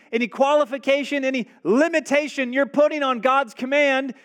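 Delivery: high, loud, unbending refusal; defiant proclamation. Acted. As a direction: hammer each word like a prophet. Moses rejects conditions, preaching.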